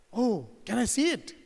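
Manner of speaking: lightly voiced eager lion, lilting question; a hint of a curious old lion. Old lion coaxing rabbit to show its bracelet, lightly acted eagerness.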